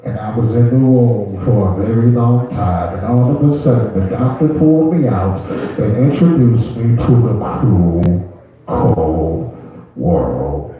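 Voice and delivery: deep, slow, mock-grave storyteller voice; deep dramatic narrator. Comic tells the crowd of his birth in a deep, slow dramatic voice.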